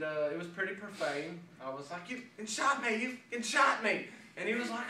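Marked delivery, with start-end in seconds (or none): 2.1-4.1 high-pitched mock scream; retold screaming. Man just shot by his friend, retold high-pitched screaming. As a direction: replay the outraged cry, pitched up. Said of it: "commenced to screaming like a woman".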